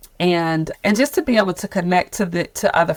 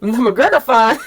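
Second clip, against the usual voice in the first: laughing answer; 6 semitones higher, 4 dB louder than usual. Retold or imitated. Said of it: "who are saying".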